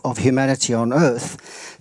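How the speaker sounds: ordinary talk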